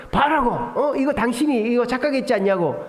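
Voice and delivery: mock accusatory insistence; retold arguing. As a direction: act out an old habit of bickering. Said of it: "막 이렇게 했었어요".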